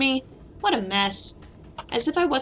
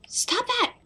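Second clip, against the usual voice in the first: much higher, sudden sharp cry of stop that; startled shout; 13 semitones higher than usual. Acted. Player yelling at the character on screen, a startled high shout. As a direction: yelp it out like you've just been startled.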